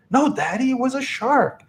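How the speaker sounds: put-on high little-girl voice, insisting; young daughter. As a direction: imitate a small child contradicting Dad. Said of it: "My daughter was like".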